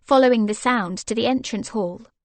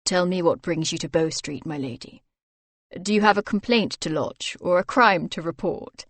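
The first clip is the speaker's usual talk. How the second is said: lightly lowered teasing husband; playful mock interrogation, a touch lower; Grant's voice; 3 semitones lower than usual. Husband teasing his wife with mock questions, lightly lowered.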